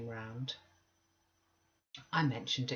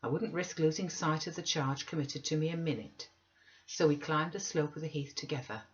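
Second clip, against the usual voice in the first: plain reading voice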